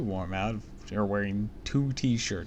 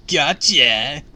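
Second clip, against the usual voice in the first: loud taunt; 5 semitones higher, 13 dB louder than usual. Acted. Kid pranking, teasing.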